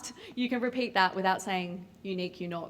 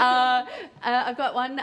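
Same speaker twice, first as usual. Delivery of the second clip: ordinary talk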